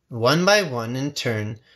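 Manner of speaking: ordinary delivery, nothing marked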